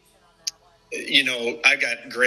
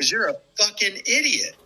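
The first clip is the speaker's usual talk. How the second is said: mock yell; 8 semitones higher than usual. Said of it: "he just screaming and yelling".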